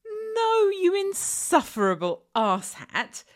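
high, loud angry retort; exasperated shout. Acted. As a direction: blurt out in fury. Exasperated insult at listener.

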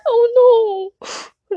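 high loud wail through laughter; giggling at own flub; laughing oh no. Real, not acted.

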